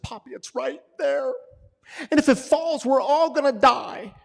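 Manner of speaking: put-on high, loud, panicked child voice; scared little daughter. Daughter crying to her dad about a spider, high panic. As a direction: play a terrified eight-year-old. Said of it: "She said".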